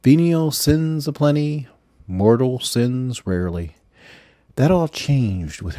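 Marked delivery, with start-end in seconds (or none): none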